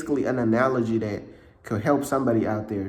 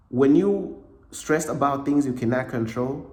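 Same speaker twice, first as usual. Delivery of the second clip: usual speaking voice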